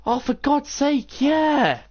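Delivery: slow and high, fed up with himself; high slow exasperated "oh for God's sake"; exasperated realization. Retold or imitated. Realizing he forgot PSP.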